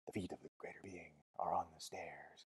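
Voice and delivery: barely audible, dying whisper; expiring whisper. A narrator's reading. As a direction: whisper it as if almost spent. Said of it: "The whisper almost expired".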